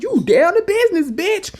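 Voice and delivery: high, loud hype at "you down to business"; mock impressed cheer; hyped-up tease. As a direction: cheer her on, teasing. Mock hyping up the character.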